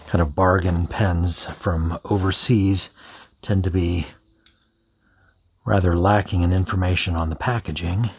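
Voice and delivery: usual speaking voice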